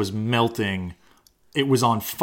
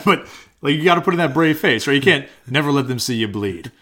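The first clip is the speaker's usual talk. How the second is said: usual speaking voice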